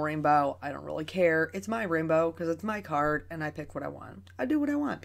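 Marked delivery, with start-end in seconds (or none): 4.4-5.0 high sassy defiance. Real, not acted. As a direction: toss it off with playful sass. Sassy defiant aside to viewers.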